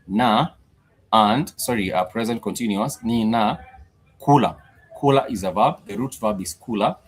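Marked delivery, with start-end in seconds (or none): 3.0-4.6 Ninakula stretched very slowly, each syllable clear, falling at the end; very slow teaching pronunciation. Shown as a demonstration. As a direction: draw out Ninakula very slowly for learners.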